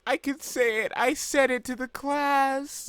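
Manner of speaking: high, loud mock-triumphant cry; hammed-up bold girlish declaration. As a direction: proclaim it high, drawing out the ending. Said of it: "like, boldly or whatever".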